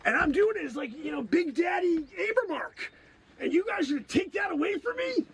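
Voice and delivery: high, aggrieved rant; indignant protest. Acted. Performer losing his Abraham role, indignant.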